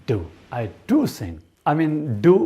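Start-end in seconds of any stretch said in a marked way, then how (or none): 0.5-1.4 emphatic "do", pitched up above "sing"; stress on "do". Shown as a demonstration. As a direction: hit the word "do" firmly. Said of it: "there is emphasis".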